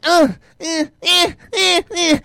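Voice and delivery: high, loud rhythmic grunts; imitated bedroom noises. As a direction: mimic straining grunts from the next room. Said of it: "He's hearing all these noises".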